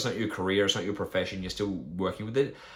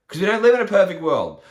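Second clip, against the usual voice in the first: higher and louder, punching it home; emphatic; 9 semitones higher, 9 dB louder than usual. Real, not acted. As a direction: drive the point home hard. Coach hammering home his point.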